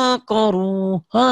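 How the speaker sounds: fa'aqaruha suddenly jumped high and rushed; teacher demonstrating a wrong reading; wrong recitation demo. Shown as a demonstration. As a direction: show the mistake in fa'aqaruha, the vowel lengths broken. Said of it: "Nggak boleh tiba-tiba".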